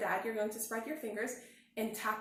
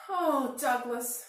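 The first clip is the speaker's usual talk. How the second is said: tender mom; 4 semitones higher, 7 dB louder, much slower than usual. A narrator's reading. Mom seeing Douglas wet and sad, slow and tender. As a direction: say it gently. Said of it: "said his mom".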